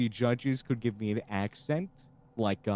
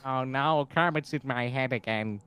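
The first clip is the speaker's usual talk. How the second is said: normal talking voice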